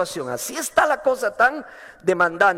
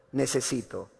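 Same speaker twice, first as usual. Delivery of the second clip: ordinary delivery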